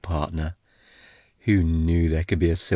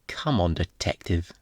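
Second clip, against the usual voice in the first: ordinary talk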